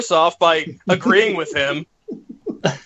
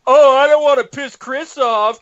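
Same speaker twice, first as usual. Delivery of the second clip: mocking quote; 6 semitones higher, 4 dB louder than usual. Mocks co-host's excuse mid-rant.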